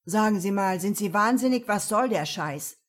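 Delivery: loud, furious protest; angry outburst. Acted. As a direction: flare up in anger. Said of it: "Ben fuhr auf". (wütend aufbrausend)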